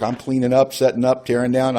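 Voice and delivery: ordinary talk, nothing marked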